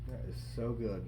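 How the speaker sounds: talking with a mouthful; chewing while speaking; mouth full. Real, not acted. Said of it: "I'm talking with my mouth full here".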